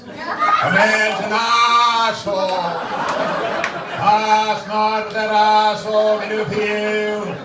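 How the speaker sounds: slow, drawn-out Kennedy Boston voice; Kennedy accent. Retold or imitated. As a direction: orate like a Kennedy. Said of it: "we become one of the Kennedys".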